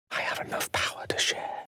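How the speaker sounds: soft breathy whisper, no voice. Acted. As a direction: murmur it like a confidence. Game character whispers line.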